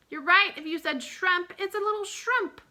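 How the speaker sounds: high and loud cheering answer; excited reveal. Acted. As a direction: praise them with a thrilled shout.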